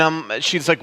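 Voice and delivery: ordinary talk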